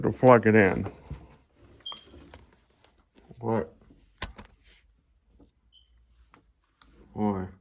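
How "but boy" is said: talking around a flashlight held in teeth; flashlight in mouth. Real, not acted. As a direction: mouth propped open by a flashlight. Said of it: "Sorry, I got a flashlight in my mouth".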